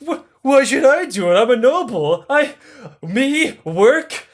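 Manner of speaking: high, whining protest of a spoiled noble; whiny noble. Retold or imitated. Noble protesting being sent to work, high and whiny. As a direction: squeal it like a lazy aristocrat. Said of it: "He's like".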